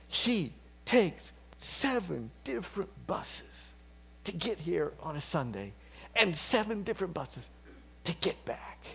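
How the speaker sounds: lightly voiced boy amazed by his mother; awed boy. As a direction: marvel at each bus. Boy proudly describing his mom.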